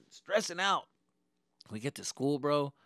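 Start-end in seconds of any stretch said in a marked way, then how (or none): none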